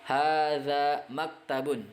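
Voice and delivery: Hadza masjidun drawn out slowly and clearly; teacher modelling Arabic slowly; slow model reading. Shown as a demonstration. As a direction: read it slowly for students to repeat. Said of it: "model Arabic readings slowly".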